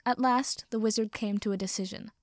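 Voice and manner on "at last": usual speaking voice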